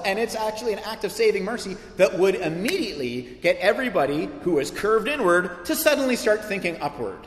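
ordinary delivery

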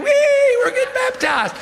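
high gleeful whee, loud and giddy; excited whoop. Retold or imitated. Preacher acting out the baptism crowd, loud gleeful whoop. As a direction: whoop like a kid splashing in the river.

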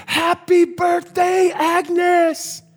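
very high, drawn-out celebratory yell; crowd's surprise shout. Acted. Diner crowd greets Agnes at her surprise party, shouting. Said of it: "they all shout and they scream".

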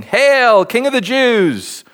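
mock acclamation. A narrator's reading. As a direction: call out a hammed-up salute to a king. Soldiers' acclamation voiced in reading.

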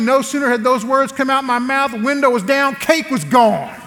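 ordinary delivery

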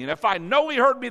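ordinary talk

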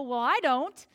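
loud, fast brush-off, rising high at the end; blunt refusal. Retold or imitated. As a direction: cut her off firmly, then go up and away. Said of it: "And I would go".

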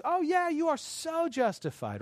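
high, slow, mock-sympathetic agreement; gushing validation. Friend echoing his anger, exaggerated high agreement. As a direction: gush like an over-agreeable friend.

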